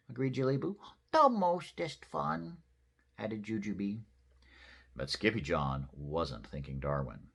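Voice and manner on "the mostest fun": put-on little girl voice. Sister speaking.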